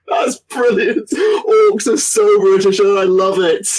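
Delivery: high, drawn-out words through real laughter; breaking up with laughter; laughing delight. Real, not acted. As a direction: gush it while still cracking up. Still cracking up at joke.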